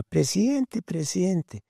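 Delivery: imitating a tiny girl's high call from the crowd; little girl calling. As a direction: pitch way up like a small girl calling out. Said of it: "yo oí una vocecita que gritaba".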